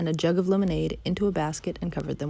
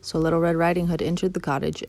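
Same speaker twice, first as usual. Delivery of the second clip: usual speaking voice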